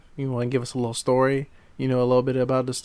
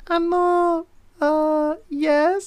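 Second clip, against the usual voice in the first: squeaky high mock girl voice; lightly imitating a timid woman; much slower than usual. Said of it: "the girl's like".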